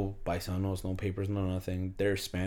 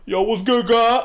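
loud, high-pitched booming New York greeting; mock New York girl; 12 semitones higher, 13 dB louder, slower than usual. Man imitating a New York girl greeting him, mock holler. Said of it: "got deep-ass voices".

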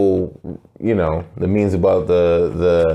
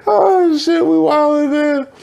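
very high laughing squeal; overcome with amusement; much slower than usual. Real, not acted. Host recovering from the old hags joke, squealing. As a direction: squeal it out, stretching the end. Crying out after laughing hard.

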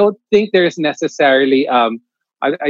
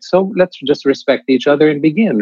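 ordinary talk

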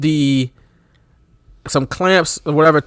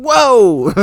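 mock oversold yelp; 8 semitones higher than usual. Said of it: "he's like".

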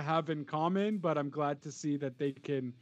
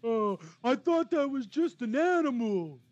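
dumb voice; 10 semitones higher, 3 dB louder, slower than usual. Retold or imitated. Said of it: "the Texans".

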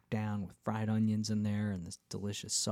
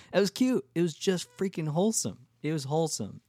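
much higher and louder, gushing over cute; gushing excitement; 8 semitones higher, 5 dB louder than usual. Real, not acted. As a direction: squeal how sweet it all was. Gushing about the wholesome town.

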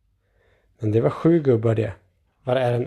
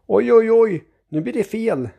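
high-pitched dismayed oh dear; alarmed; 10 semitones higher, 4 dB louder than usual. A narrator's reading. Grandfather watching the egg being squeezed, alarmed. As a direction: fret aloud as it goes wrong.

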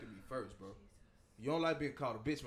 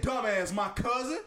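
incredulous; 8 semitones higher, 10 dB louder, slower than usual. Retold or imitated. Retold reply in the argument.

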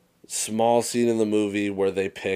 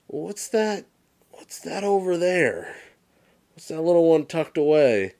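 sly dad; 9 semitones higher than usual. Retold or imitated. Dad points out hidden gift, much higher teasing voice. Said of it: "he hits him with the".

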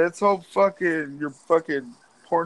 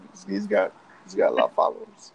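mock deep voice; 10 semitones lower than usual. Mocking the comedian's fame.

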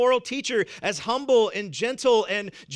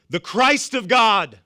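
The Christ of God proclaimed slowly and louder; solemn proclamation; 3 semitones higher, 8 dB louder, slower than usual. Acted. Preacher to church family, a slow, louder proclamation. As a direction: lean into each word as a declaration. Climactic answer in sermon.

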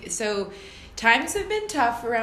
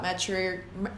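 ordinary delivery

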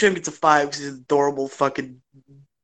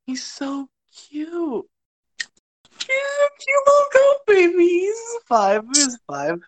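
cooing; 14 semitones higher than usual. Real, not acted. Doting on the little goat, high cooing baby talk. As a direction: coo it like talking to a pet.

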